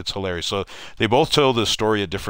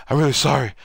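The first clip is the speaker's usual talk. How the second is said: loud, high, puffed-out mock apology; winded apology; 6 semitones higher, 4 dB louder, faster than usual. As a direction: imitate an exhausted player saying sorry. Retold winded player apologizing on sideline.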